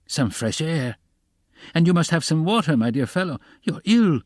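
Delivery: high, fussing, anxious voice; worried, urgent sympathy; alarmed concern. A narrator's reading. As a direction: fuss over someone who is ill. Alarmed man fusses over sick visitor.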